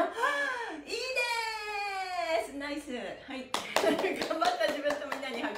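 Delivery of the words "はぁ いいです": long, high, slow call to the class; drawn-out call. Real, not acted. Instructor calling out to class. (高く伸ばして呼びかける)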